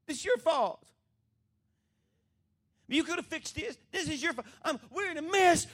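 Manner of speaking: high, loud accusing outburst; acted blaming. Preacher paraphrases Mary blaming Jesus, high accusing outburst.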